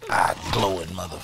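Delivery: loud startled outburst; sudden shout. Real, not acted. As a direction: blurt it out.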